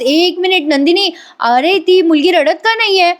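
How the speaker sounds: high-pitched worried question; retold alarm. Woman on video call notices newborn is silent, alarmed. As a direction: stop everyone, pitch jumping with concern.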